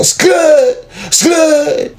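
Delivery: high piercing mock tire screech; skid sound. Retelling the getaway, a high mock tire screech. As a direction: shriek it out like tires skidding. Imitating tires skidding in retelling.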